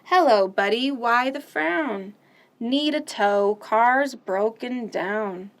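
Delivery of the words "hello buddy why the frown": ordinary delivery, nothing marked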